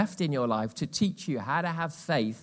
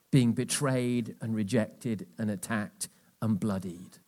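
ordinary talk

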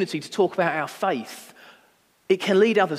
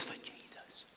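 hushed, as if shy of being overheard; embarrassed, barely audible mumble; lowered voice; 26 dB quieter, slower than usual. Retold or imitated. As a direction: drop to a near whisper on the name. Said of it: "I was lowering my voice".

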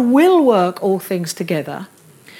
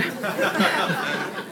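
soft laugh; 5 semitones lower, 10 dB quieter than usual. Real, not acted. Laughs at own singleness joke.

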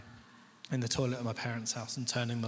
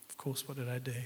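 usual speaking voice

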